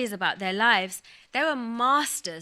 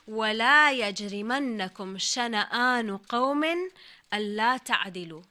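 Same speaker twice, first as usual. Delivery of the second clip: Quran recitation; 4 dB louder, much faster than usual. Shown as a demonstration. Quoting Quranic verse in Arabic.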